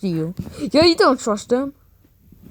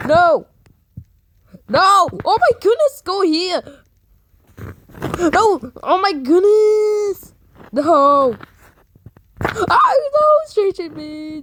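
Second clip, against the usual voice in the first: scared horror game streamer; 8 semitones higher than usual. Real, not acted. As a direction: yell in panic as it chases you. Being chased by monster.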